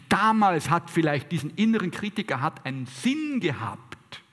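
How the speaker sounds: usual speaking voice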